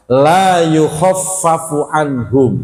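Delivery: slow, drawn-out chanted recitation of verse; Quran recitation. Acted. Preacher reciting the verse to the congregation, slow chant. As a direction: chant the verse slowly as in tilawah.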